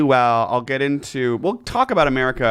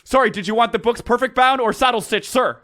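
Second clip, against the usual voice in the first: high stiff soldier question; stiff barking toy soldier; 12 semitones higher than usual. Pretend. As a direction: ask like an eager cadet. Soldier roleplay continues.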